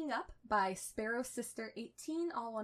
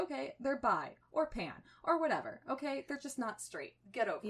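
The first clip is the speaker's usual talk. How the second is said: exasperated; 5 semitones higher, 5 dB louder than usual. Retold or imitated. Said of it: "I'm like".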